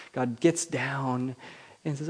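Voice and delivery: ordinary delivery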